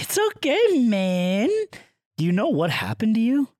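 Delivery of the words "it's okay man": much higher, soothing reassurance; high comforting voice. Retold or imitated. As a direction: coo it like comforting a child. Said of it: "I was like".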